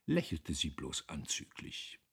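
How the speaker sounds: ordinary delivery, nothing marked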